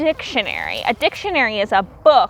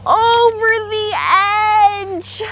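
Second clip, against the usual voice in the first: dramatic; 8 semitones higher, slower than usual. A narrator's reading. Dramatic cliffhanger in story.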